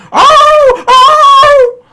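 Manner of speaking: dog howl. Retold or imitated. Dog howling in excruciating pain at the farm, hammed-up howl. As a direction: howl like the dog can't take it anymore. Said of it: "the dog's howling".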